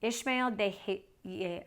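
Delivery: ordinary delivery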